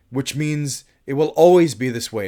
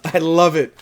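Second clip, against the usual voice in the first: ordinary delivery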